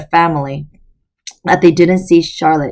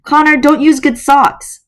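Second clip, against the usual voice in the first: mother's voice; 9 semitones higher, 4 dB louder than usual. Acted. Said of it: "Charlotte said".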